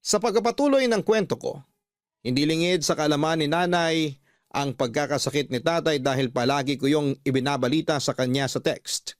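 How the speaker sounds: ordinary delivery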